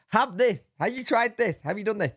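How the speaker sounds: high-pitched string of nagging questions; pestering chat imitation.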